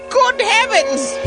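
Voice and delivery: high, slow gasp of horror; gasp, then clutch pearls; gasping shock. Acted. Sudden gasp of realisation.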